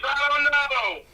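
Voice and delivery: high, loud, mock-angry shutdown; retold snap at a pest; exasperated outburst. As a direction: imitate a boss losing patience. Chief snapping at persistent questions.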